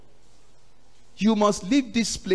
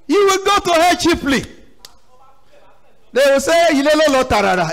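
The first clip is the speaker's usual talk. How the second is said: high, loud preacher's outburst; loud warning; 10 semitones higher, 8 dB louder than usual. Real, not acted. Preacher warns the congregation about hell, loud warning. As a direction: cry out the warning at full voice.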